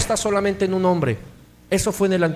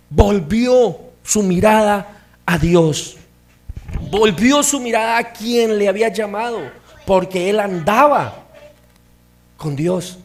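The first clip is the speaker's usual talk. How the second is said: fervent preaching; 4 semitones higher, 6 dB louder than usual. Acted. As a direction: stress each word to the congregation. Preaching emphasis.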